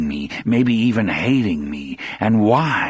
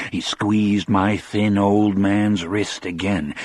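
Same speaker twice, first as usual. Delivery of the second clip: usual speaking voice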